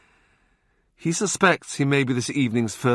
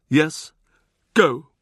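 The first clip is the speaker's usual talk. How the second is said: short, louder command to leave; curt dismissal; 6 semitones higher, 4 dB louder than usual. Acted. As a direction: send him off with a snap. Wolsey dismisses Boleyn.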